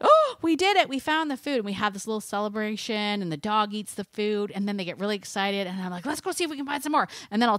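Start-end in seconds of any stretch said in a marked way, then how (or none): 0.0-1.6 much higher and louder, a burst of joy; excited celebration. Retold or imitated. Owner cheering to her dogs after the find, excited. 6.0-7.1 higher and fast, rushing the words out; eager invitation. Retold or imitated. Owner urging her dogs to hunt more, eager and fast.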